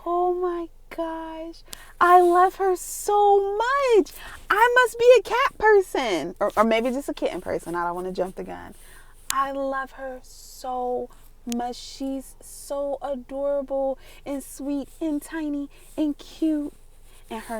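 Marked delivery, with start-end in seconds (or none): 0.1-6.3 very high, drawn-out squealing coo; gushing. Real, not acted. Owner raving about the new kitten, gushing. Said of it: "Notice the change of tone". 9.3-16.7 adoring coo. Real, not acted. Owner doting on the new kitten, adoring coo. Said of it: "Notice the change of tone".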